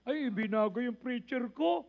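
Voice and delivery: lightly voiced low grumble of a churchgoer; mock complaining member. Preacher mimics a grumbling member: low mock whine. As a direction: mimic a member whining about a new preacher.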